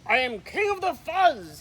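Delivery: loud snarling boast of a bully dog; snarled. A narrator's reading. Big Bark snarls to the prairie dogs, claiming the fuzz, loud snarl. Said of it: "he snarled".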